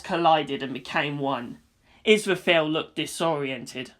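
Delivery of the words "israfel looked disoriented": ordinary delivery, nothing marked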